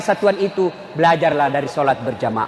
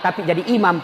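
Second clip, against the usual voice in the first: usual speaking voice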